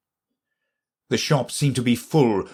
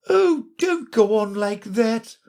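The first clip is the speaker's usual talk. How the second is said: put-on high, anxious old Queen voice; the Queen fussing over crying Alice; fretful Queen; 11 semitones higher than usual. A narrator's reading. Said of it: "cried the poor Queen".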